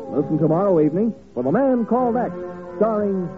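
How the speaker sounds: ordinary talk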